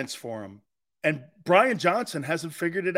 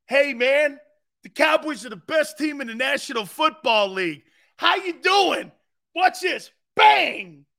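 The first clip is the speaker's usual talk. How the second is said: higher, louder boastful shouting; hammed-up hype; 5 semitones higher, 4 dB louder than usual. Host mimicking a Cowboys booster, hammed-up loud hype. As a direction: crow it loudly, building to a shouted bang. Hyping guest in a put-on voice.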